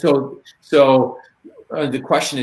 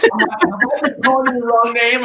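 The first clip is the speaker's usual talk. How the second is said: much higher, hammed-up worried self-quote; mock panic; 12 semitones higher, 3 dB louder than usual. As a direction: replay your own alarmed thought, squeaky and high. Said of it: "Okay, I'm like".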